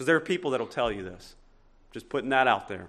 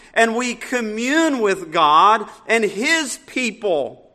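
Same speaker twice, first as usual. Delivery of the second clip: louder, slow, swelling preacher's cadence; full-force preaching; 7 semitones higher, 12 dB louder, slower than usual. Acted. Pastor proclaiming communion to the church, full-force preaching. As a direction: proclaim it to the whole congregation.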